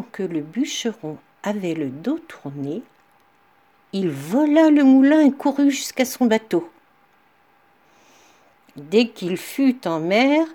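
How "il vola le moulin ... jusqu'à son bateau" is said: plain reading voice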